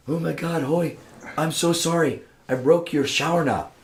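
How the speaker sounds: put-on apologetic Chris in a light British accent. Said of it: "comes out with his British accent".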